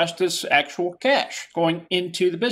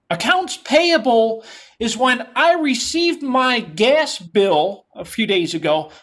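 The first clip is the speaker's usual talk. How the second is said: ordinary delivery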